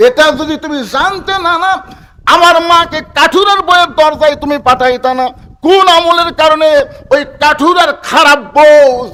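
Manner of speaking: loud, high accusing outburst; acted aggrieved protest. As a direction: hurl the grievance at grandfather, chanting high. হোসেনের অভিযোগ অভিনয়. (ক্ষোভে চড়া গলায় অভিযোগ)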